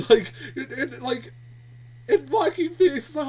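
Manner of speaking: usual speaking voice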